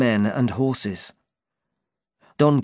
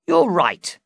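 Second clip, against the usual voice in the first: high, pleased reply; happy agreement; 8 semitones higher, 5 dB louder than usual. Acted. Knight happily agrees with the driver, pleased. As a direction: agree with delight. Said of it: "said Don Quixote happily".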